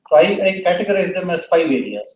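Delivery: ordinary talk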